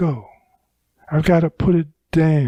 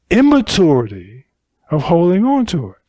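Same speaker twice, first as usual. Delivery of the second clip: ordinary delivery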